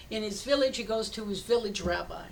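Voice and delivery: normal talking voice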